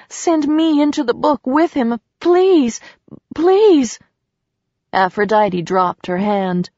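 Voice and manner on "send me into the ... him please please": high, anguished begging; desperate pleading. A narrator's reading. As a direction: beg harder with each high, falling please. Woman begs goddess to join lover.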